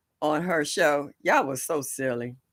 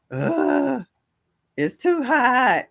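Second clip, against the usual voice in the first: mock complaint; 8 semitones higher, much slower than usual. Mock complaining about heat.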